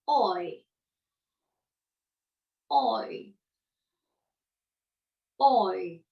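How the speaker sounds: "Oi" from back O sliding to the front; teacher's model diphthong. Shown as a demonstration. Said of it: "Répétez".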